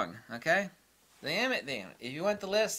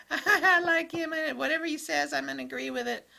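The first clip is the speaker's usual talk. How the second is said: mocking fanboy; 11 semitones higher than usual. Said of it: "It's like".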